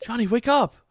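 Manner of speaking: mock panicked call. Panicking over fallen game character.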